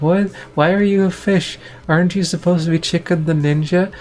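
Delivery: high-pitched mock bewilderment; baffled cartoon characters, voice jumping up high; totally confused. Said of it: "totally confused".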